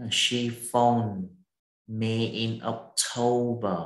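very slow, each syllable of "October" drawn out; slow model reading. Shown as a demonstration. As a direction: read it slowly so the student can follow. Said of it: "Chữ October mình đọc là October". (đọc mẫu chậm "me in October")

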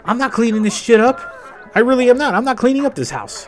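high-pitched indignant rant; high mock rant. Stepson scolding the messy stepmom, high and indignant. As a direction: complain at her in a high huffy voice.

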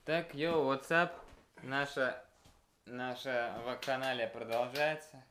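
ordinary talk

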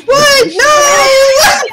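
high, loud horrified cry of disbelief; shocked cry. Acted. Guard hearing that his friend was eaten, crying out in horror. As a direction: scream your denial.

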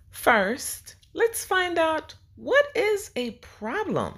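bubbly classroom question; excited teacher. Acted. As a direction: pitch way up to hook the kids.

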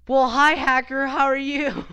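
loud, high, fake-friendly hello; sarcastic sing-song greeting; mock cheerful greeting. Real, not acted.

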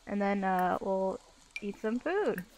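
ordinary talk, nothing marked

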